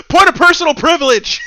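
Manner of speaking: loud, high mocking yell; hammed-up shrieking protest; mock shout. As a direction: bellow it like a fussy heckler. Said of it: "he's like".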